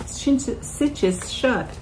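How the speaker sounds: tripping on CINCHES, its first sound slurred towards sh; stumbling over pronunciation. Real, not acted. Showing viewers how the bag shuts, tongue-tied on CINCHES. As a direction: stumble on CINCHES and have a second go. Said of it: "Can't say the word".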